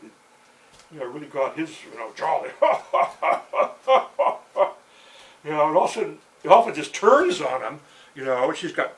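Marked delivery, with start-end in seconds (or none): 2.5-5.4 big, loud, high belly laugh; roaring laughter. Acted. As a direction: roar with laughter, long and loud. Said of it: "roaring with laughter".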